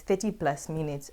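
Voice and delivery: usual speaking voice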